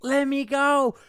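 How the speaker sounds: high-pitched imitation of her; mock struggling plea. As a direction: squeal it as a mocking impression. Mimicking her struggling to get free.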